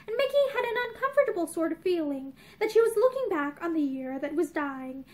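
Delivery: ordinary delivery, nothing marked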